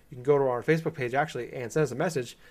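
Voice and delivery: rushed, fast podcast plug; talking really fast. Real, not acted. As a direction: rattle it off quickly. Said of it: "I'm talking really fast".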